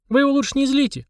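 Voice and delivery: put-on high-pitched girl's warning. A narrator's reading. Polina warning her friends about the demon, warning. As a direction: warn them in a high girlish voice. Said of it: "предупредила Полина".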